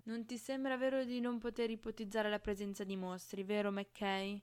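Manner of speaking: plain reading voice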